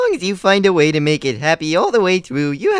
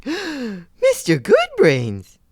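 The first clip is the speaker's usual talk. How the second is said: gasp, then stretch the name out slowly in dismay; scandalized, as if betrayed by a friend; shocked gasp; 5 semitones higher, much slower than usual. Acted. Snuffy gasping at his friend's betrayal, slow and scandalized.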